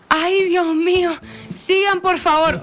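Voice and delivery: high, slow, swooning welcome; flirty mom. Retold or imitated. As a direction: drool over the firemen, slowly. Mamá coquetea a los bomberos. (mamá coqueta, lenta y aguda)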